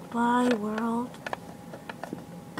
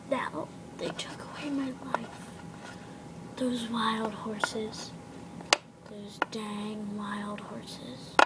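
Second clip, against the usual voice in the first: slow, breathy dramatic whisper; 5 semitones lower, 17 dB quieter, slower than usual. Acted. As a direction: whisper it slowly, full of grief.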